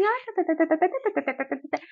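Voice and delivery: high, soft, mocking tê-tê chatter; imitating the nurses' chatter, high and soft; mocking chatter noise. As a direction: mimic idle gossip as noise. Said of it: "Aí elas olhavam assim".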